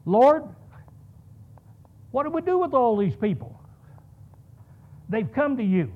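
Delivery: calling out to the Lord. Retold or imitated. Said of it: "Not until you ask Christ".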